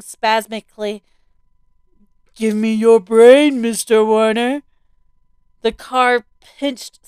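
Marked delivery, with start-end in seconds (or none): 2.3-4.6 zombie voice. A narrator's reading. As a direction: drag the words out like a hungry dead man. Zombie at the car window.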